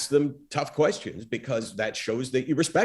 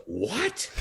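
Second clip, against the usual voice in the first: mock-incredulous, pitched up at the end; offended disbelief; 4 semitones higher than usual. Retelling a guest's reply to the host's challenge, offended disbelief. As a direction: act out a rival taken aback. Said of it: "he was pissed".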